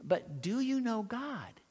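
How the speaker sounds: put-on a small boy pressing the question gently; lightly voiced child; little boy voice. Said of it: "the little guy says".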